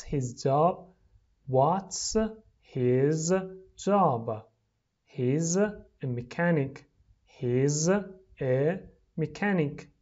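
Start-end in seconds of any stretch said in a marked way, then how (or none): 1.5-4.4 "What's ... his ... job?" with pauses between words; slow, separated model reading for repeating. Shown as a demonstration. Teacher drills the question for repeating, word by word. 7.2-9.9 "He's ... a ... mechanic" slowly, with pauses between words; slow, separated model reading for repeating. Shown as a demonstration. Teacher drills the answer for repeating, word by word.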